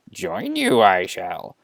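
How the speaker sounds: slow creature voice with a swooping middle; a little Jedi master speaking slowly; Yoda. Acted. Yoda walks in and volunteers, in a slow creature voice. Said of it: "Yoda walks in and says".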